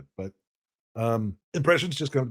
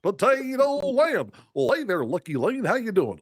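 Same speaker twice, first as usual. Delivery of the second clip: high, slow goofy cartoon drawl; 8 semitones higher, slower than usual. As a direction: stretch it out like Goofy. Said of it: "your Goofy impression".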